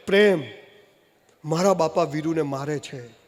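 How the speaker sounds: ordinary talk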